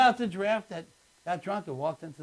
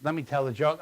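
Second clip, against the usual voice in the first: ordinary delivery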